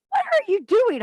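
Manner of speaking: high, loud mock husband voice. Husband asking his wife what she's doing, in a high mocking voice. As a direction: squeak out his baffled question. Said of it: "he'll go".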